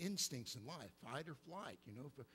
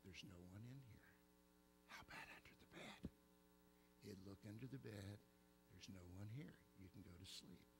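calm reassuring dad; 5 semitones lower, 8 dB quieter than usual. Retold or imitated. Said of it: "and he'd say".

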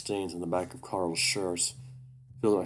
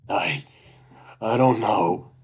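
scared; 3 semitones higher, 9 dB louder, slower than usual. Acted. Cornered by the creature.

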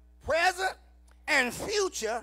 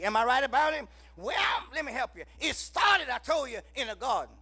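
ordinary talk, nothing marked

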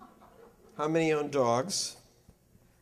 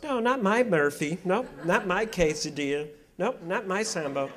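indignant dog owner, voice higher and louder; mock denial; 5 semitones higher, 4 dB louder than usual. Dog owner denying to the lecturer, mock indignant. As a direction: protest like a doting owner who won't believe it. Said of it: "every dog owner goes".

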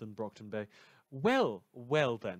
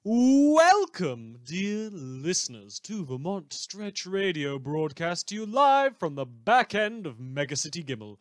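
big, bright radio host welcome, much higher on welcome; radio announcer; 4 semitones higher, 8 dB louder than usual. Acted. Fictional radio host greets listeners in announcer voice.

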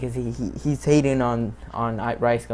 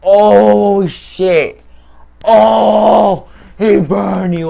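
high, loud screaming hype; hyped shout; 8 semitones higher, 13 dB louder, much slower than usual. Real, not acted. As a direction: yell like the burn just landed on you. Reacting to a brutal burn.

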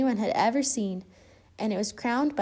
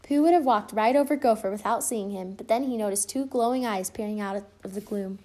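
ordinary delivery

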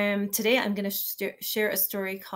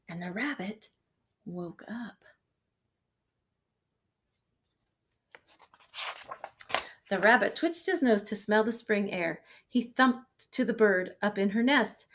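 put-on reading to children; 3 dB louder than usual. Reads picture book to kindergarten.

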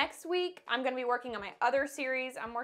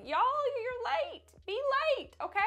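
much higher, teasing sing-song; mock reassurance; 14 semitones higher, 3 dB louder than usual. To someone running late, higher mock-cheerful reassurance. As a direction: playfully tell a late friend it's fine. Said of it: "you're like".

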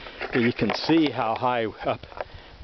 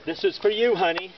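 tender; 7 semitones higher than usual. Real, not acted. Sweet message to wife.

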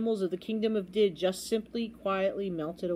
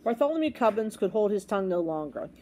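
ordinary delivery

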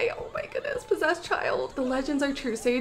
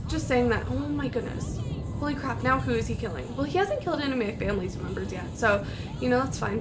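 ordinary talk

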